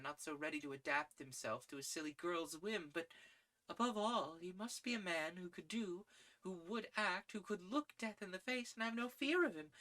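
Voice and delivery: a lady dreaming of heroes; Gladys rapturous. A narrator's reading. Gladys describes her ideal.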